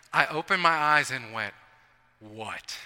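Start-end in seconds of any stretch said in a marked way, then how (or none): 2.2-2.8 low, soft, sleepy what; groggy. Retold or imitated. Woken at two AM by his roommate, a groggy answer. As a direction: grunt it half awake.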